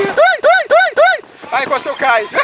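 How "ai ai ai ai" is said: high, loud frightened screams; screaming. Real, not acted. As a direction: shriek with fear on the drop. Screaming on a ride.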